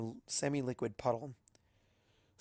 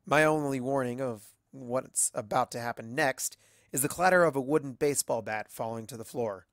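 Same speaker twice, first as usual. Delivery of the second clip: ordinary delivery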